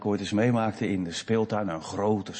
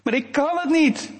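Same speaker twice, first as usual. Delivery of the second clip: put-on high, louder little-boy protest; boy calling down; 6 dB louder than usual. Scared boy calling down to his sister from the slide, high protest. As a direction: wail down that you can't do it. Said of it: "ik hoorde het hem nog roepen".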